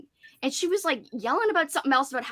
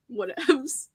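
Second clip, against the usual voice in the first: normal talking voice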